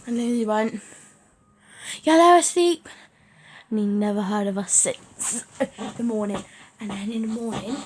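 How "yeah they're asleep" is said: loud, raised-pitch reply; calling back. Retold or imitated. Cousin's brother reports to nan, calling loudly. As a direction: holler the report across the house.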